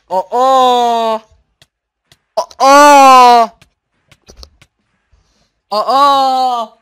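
long, very loud, high raging screams; furious screams. Real, not acted. Gamer screaming at the quiz after failing again, raw rage. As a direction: scream out the frustration at full force.

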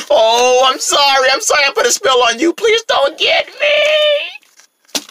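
high wail, ending very drawn out; desperate pleading. Acted. Rabbit begging pig for mercy, desperate. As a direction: beg in a rising squeal.

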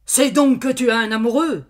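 loud, high accusing shout; shouted in her face. Acted. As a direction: yell it right in her face. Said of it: "lui cria dans la face".

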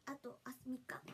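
soft whisper to self; whispered count. Real, not acted. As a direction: murmur it under the breath. 独り言のささやき. (ささやき声で)

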